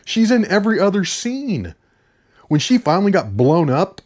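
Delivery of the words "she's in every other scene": exasperated. Retold or imitated. Reviewer recalls reacting to an actress who won't leave the film, exasperated. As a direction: groan the words out in higher-pitched disbelief. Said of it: "I was like".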